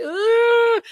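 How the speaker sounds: a high, loud, hammed-up sob of a groan; mock crying groan. Retelling a contestant breaking down, mock sob. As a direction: burst into a fake teary ugh. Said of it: "And she starts to cry".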